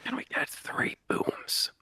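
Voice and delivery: laughing ASMR whisper. Pretend. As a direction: whisper through giggles. Joining the ASMR whisper bit.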